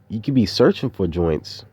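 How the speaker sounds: ordinary talk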